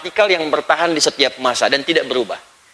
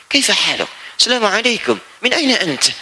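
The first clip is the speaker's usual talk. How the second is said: a light native Arabic accent, greeting a friend; lightly put-on Arabic accent, showing Indonesians sound native. Shown as a demonstration. Preacher to congregation, mimicking a native speaker in an Arabic accent.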